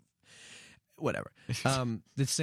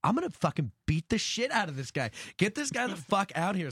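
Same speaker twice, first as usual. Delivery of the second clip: higher-pitched threat, imitating a yell without full volume; mock yell, pitched up; retold angry yelling; 6 semitones higher than usual. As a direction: re-enact an angry demand at moderate volume. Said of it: "I'm just screaming and fucking yelling".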